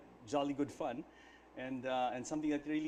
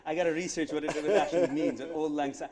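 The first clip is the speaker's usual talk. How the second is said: talking through a laugh, louder; 3 semitones higher, 10 dB louder than usual. Real, not acted. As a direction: laugh it out while admitting it. Laughing at own ignorance.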